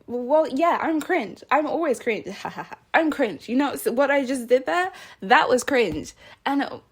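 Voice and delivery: giddy, punchy excitement with bursts of laughter; getting all excited. Real, not acted. Speaker happily owning being cringe to viewers, excited. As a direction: get carried away, laughing as you own it. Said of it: "Sorry, I'm getting all excited".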